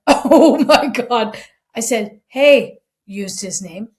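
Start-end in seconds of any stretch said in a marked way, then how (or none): 0.1-1.4 loud, slow, drawn-out disbelief; stunned. Retold or imitated. Hearing the golfer's tirade, a loud stunned oh my God. 2.3-2.8 loud, raised hey; firm call. Retold or imitated. Interrupting the ranting golfer, a loud firm hey.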